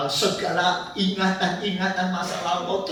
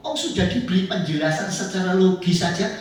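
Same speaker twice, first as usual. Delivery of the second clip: ordinary talk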